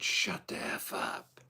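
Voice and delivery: slow hushed whisper; whispered shut-up. Retold or imitated. Alone in the car, telling intrusive thoughts off in a slow whisper. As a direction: hiss it slowly under your breath. Telling his mind off while driving.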